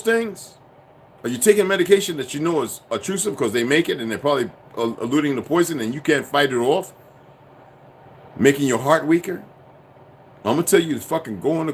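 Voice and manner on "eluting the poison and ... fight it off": ordinary talk, nothing marked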